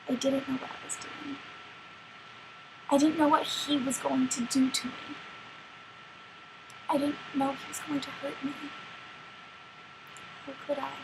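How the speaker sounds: hushed, regretful dramatic monologue; Little Red. Acted. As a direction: trail into quiet self-reproach, pausing between thoughts. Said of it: "it's in the perspective of Little Red".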